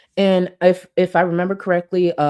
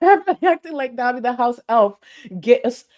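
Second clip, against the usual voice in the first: loud, high laugh into mocking; laughing mockery; 6 semitones higher, 5 dB louder than usual. Real, not acted. Laughing while mocking the streamer's act. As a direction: laugh as you mock her.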